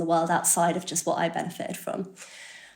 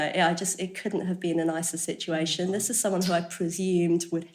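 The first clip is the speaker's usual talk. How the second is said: ordinary talk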